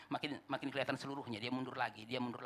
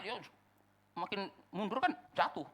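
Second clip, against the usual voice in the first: ordinary talk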